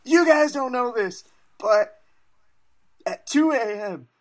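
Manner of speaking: mock breathless Joe confiding; hammed-up high-pitched Joe; Joe Rogan impression. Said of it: "Joe prefaces it by being like".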